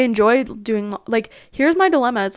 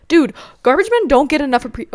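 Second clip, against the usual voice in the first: louder, higher, worked-up praise; amped up; 5 semitones higher, 8 dB louder than usual. Real, not acted. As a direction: get fired up about the garbage men. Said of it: "I'm, like, amping up right now".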